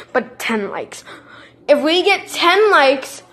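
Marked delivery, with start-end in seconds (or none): 0.1-1.0 laughing whisper. Real, not acted. Laughing aside after joke.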